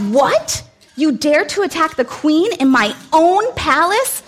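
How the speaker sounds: king shouts to take Haman away; shouted. Acted. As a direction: roar the order at the guards. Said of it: "he shouted".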